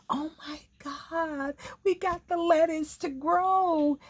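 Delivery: high, gushing gardener voice, imitated. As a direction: mimic a gardener squealing about lettuce. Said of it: "They'll say".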